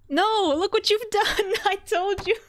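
an exasperated cry broken by laughter; laughing despair. Real, not acted. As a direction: laugh through the disbelief.

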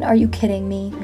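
ordinary delivery

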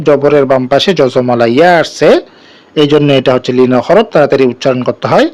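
ordinary delivery

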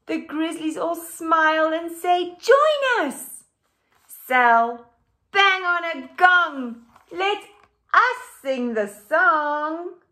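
animated picture book read-aloud; reading to children. A narrator's reading. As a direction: read it out lively for the class.

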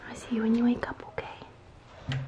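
soft whispered goodnight. Real, not acted. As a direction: whisper it so she sleeps. Whispering goodbye at crib.

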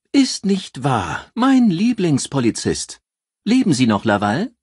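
high, loud, drawn-out delighted greeting; lively newspaper editor. A narrator's reading. Eccentric editor Joyce greets the detective on the phone, high and gushing. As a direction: play her as a gushing, theatrical editor thrilled to hear from him.

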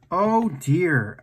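ordinary delivery

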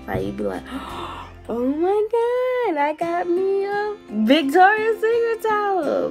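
ordinary talk

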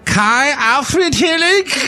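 slow, high, exaggerated German accent. Shown as a demonstration. Host hams up guest's German-sounding name, mock German accent. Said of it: "it sounds vaguely German".

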